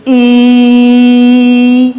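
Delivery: held-out ई demo; prolonged vowel. Shown as a demonstration. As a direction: hold ई out like a sustained note. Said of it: "हमने start किया था ई बोलना".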